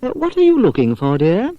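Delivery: a mother gently asking her son; mother's voice. Acted.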